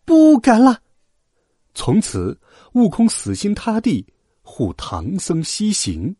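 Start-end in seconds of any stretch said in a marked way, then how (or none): 0.0-0.8 high, submissive squeal; high-pitched chastened pupil, louder on the repeat; meek 悟空. A narrator's reading. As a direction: give in meekly in a very high voice. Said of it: "悟空老老实实说".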